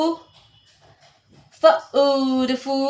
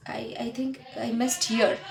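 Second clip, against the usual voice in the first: whispered aside; 9 dB quieter than usual. Real, not acted. Muttering aside to herself.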